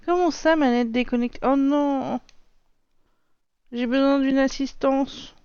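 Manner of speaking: high, loud "oh no"; dismayed surprise. Real, not acted. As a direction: caught off guard by the disconnect. Controller disconnects mid-game.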